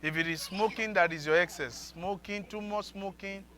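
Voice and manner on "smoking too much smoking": ordinary talk, nothing marked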